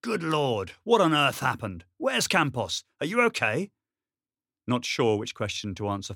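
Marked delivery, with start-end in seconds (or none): 0.0-3.7 alarmed. Acted. Commander alarmed at injured soldier.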